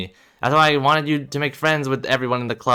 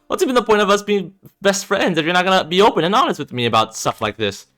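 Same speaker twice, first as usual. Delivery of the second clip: loud, high, wounded accusation; hurt outburst; 9 semitones higher, 4 dB louder than usual. Acted. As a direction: demand an answer from your best friend. Confronting friend's hidden diagnosis.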